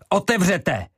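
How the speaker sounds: high, loud command to open; shout. Acted. Ivo demanding the door be opened, shouting. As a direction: bark the order at the door. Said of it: "křikl".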